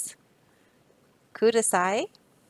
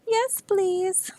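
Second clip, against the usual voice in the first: very high squeak of relief; tiny high-pitched plea; squeaky yes please; 11 semitones higher than usual. Acted. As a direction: squeak it out eagerly. Otter answers bee.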